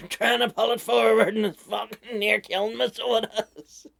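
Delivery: breathless, loud complaint from the exertion; straining with effort. Real, not acted. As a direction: heave the words out under physical strain. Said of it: "struggles to breathe".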